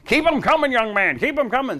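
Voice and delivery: playing the pleased big boss; imitating Rush. Rush handing cash to young man, booming.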